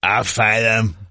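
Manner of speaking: imitated hammered tough-guy accent; mock a slurred fighter's accent; Hardy. Co-host echoing the fight film line, mock hammered accent.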